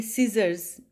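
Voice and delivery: सीजर्स said as "sizərz" with no c sound; silent c. Shown as a demonstration. Teacher showing students the silent c in सीजर्स. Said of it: "सीजर्स में सी नहीं बोला जाता".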